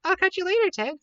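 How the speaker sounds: high, bubbly send-off with a kiss; cheerful farewell kiss; playful goodbye. Real, not acted.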